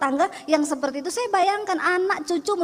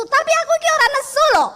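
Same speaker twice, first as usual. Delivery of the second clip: insisting loudly; 9 semitones higher, 6 dB louder than usual. Retold or imitated. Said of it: "say, kalau buat orang Solo tuh nesu".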